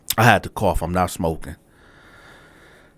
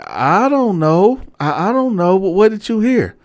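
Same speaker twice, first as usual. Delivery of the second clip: lightly voiced flustered old man, pitched higher; flustered cellmate; 9 semitones higher than usual. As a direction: play him caught out and apologizing, higher up. Cellmate voiced dodging question.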